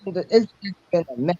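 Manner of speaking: usual speaking voice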